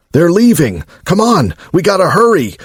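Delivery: urgent. A narrator's reading. Cyborg urging haste.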